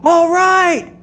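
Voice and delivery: very high, called out from far off; son cheering from afar. Retold or imitated. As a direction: shout it like a kid across the house. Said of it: "I hear this".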